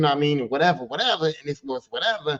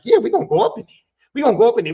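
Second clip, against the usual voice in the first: eager high yes; 5 semitones higher, 7 dB louder, much faster than usual. Retold or imitated. Excited agreement retold.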